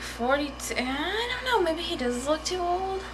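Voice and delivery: high, drawn-out waver of doubt; doubtful musing. Retold or imitated. Vlogger weighing whether the actor looks too old, high doubtful musing. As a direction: think aloud in a high, unsure voice.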